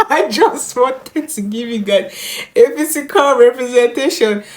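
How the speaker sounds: giggling through the words; laughing. Real, not acted. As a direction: laugh while talking. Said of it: "ugly crier".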